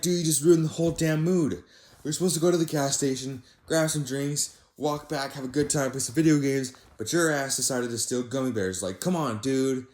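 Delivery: high, loud exasperated scolding; exasperated rant. Retold or imitated. Scolding his friend for stealing gummy bears, exasperated and loud. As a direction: let him have it, fed up.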